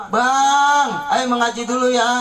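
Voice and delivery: high, sweet pleading call to her husband; coaxing wife voice. Retold or imitated.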